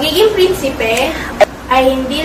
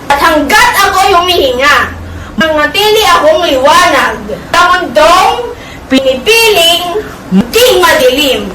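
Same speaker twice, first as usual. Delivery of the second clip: loud, rising theatrical declaration; defiant vow; 3 semitones higher, 4 dB louder than usual. Acted. Don Juan vowing to stay light in darkness, defiant declaration. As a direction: build to a ringing climax. Climactic vow at monologue's end.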